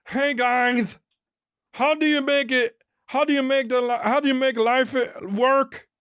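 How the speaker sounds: very high dopey falsetto; hammed-up helpless dad stumbling through words; dumb commercial dad. Dad wanders into an ad, mock idiot falsetto. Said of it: "the dad'll come in".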